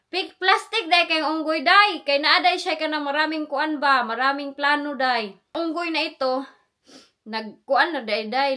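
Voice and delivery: loud, fired-up gossip rant; heated rant. Real, not acted. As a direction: vent loudly about the backstabber. Heated rant about the traitor coworker.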